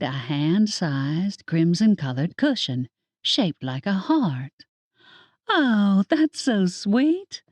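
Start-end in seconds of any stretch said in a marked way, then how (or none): 5.5-7.4 high and slow, gushing sweetly; beaming, drawn-out gush. A narrator's reading. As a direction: coo it like the gift melts you. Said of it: "I beamed at Betty".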